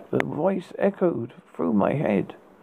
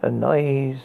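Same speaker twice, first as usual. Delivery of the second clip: ordinary delivery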